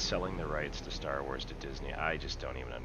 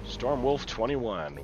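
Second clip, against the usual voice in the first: mock announcer; 7 semitones higher, 7 dB louder, much slower than usual.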